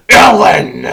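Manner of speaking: very loud falling yell; harsh shout. Acted. Machine turns on the woman captive, menacing shout. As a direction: roar the name out. Calls next victim.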